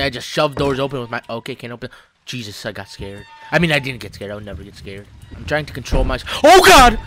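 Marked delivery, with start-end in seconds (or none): 6.4-6.9 sudden high, very loud yell; startled scream. Real, not acted. Player jolted by the scare after claiming control, startled scream. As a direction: cry out in shock at a jump scare.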